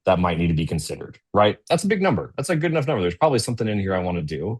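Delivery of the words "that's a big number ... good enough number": impressed. Real, not acted. Presenter reacting to two million dollars in sales, high and impressed. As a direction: pitch jumps way up on "That's a big number".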